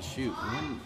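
ordinary talk

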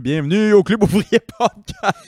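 loud, slow show announcer. Acted. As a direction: belt out the show welcome. Host announces show name.

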